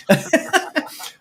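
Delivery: airy, high laugh at himself; breathy laugh. Real, not acted. Pleased with his own writing, breathy laugh. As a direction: let out a quick breathy chuckle.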